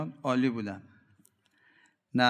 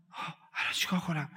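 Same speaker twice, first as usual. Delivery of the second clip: mock fretting; 4 semitones higher, 3 dB quieter than usual. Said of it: "دیدی بعضی اینجوری هن؟".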